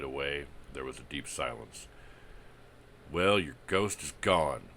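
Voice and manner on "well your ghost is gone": boasting. Acted. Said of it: "he heard Jay Turner boast".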